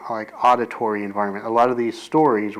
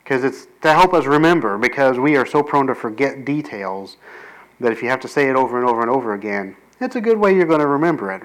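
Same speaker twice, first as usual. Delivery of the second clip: usual speaking voice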